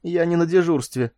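a touch of feeble indignation; weak but dignified. A narrator's reading. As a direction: retort with what dignity is left. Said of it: "отпарировал Теки слабо, но с достоинством".

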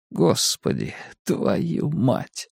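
soft swearing under the breath; hushed curse. A narrator's reading. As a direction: curse quietly in fear. Victim's frightened inner swearing. (тихо выругаться про себя)

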